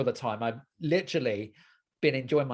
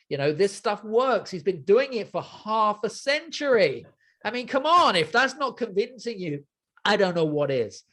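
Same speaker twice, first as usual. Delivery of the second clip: excited urging; 6 semitones higher, 4 dB louder than usual. Real, not acted. Host urging listeners to act.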